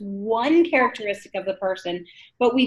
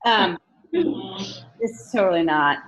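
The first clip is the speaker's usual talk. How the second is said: ordinary talk, nothing marked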